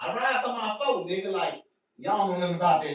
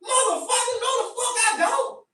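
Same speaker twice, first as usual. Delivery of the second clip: indignant denial. Retold or imitated. Angry denial to the stranger.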